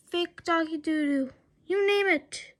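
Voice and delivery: ordinary delivery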